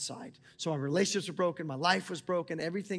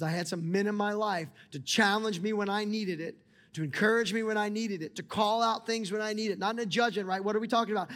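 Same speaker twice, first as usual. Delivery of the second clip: raised, higher, louder sermon delivery; impassioned preaching; 5 semitones higher, 5 dB louder than usual. Acted. Pastor urging the church to need community, preaching at full force. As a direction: drive the point home to the congregation.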